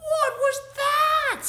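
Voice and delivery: high, loud squeal of surprise; astonished exclamation. A narrator's reading. Kitten glimpses himself in the mirror, amazed cry. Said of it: "he exclaimed".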